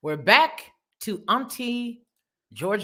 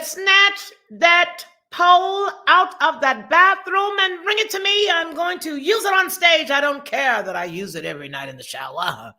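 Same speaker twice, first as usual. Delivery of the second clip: [name]; 4 semitones higher, 4 dB louder than usual. Retold or imitated. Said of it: "[name] said".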